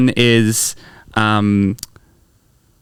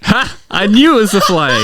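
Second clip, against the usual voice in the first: high hammed-up gotcha; a high "Ha!", then crow it; mock triumph; 8 semitones higher than usual. Friend catching Mike's lie, overacted triumph. Acted skit, guessed the lie.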